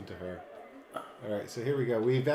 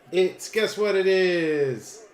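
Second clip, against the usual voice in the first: excited; 7 semitones higher, 7 dB louder, slower than usual. Real, not acted. Excited reveal of location.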